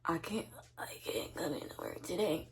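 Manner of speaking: weak, whispered sick voice; faint hoarse whisper of a sick caller; sick raspy whisper. Shown as a demonstration. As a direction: sound too sick to talk. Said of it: "my throat is so raspy".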